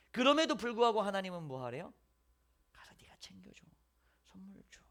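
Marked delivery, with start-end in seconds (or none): 2.7-4.8 soft whisper of God's gentle command. Acted. God whispering to Jonah to go give the gift, hushed. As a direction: breathe the instruction softly.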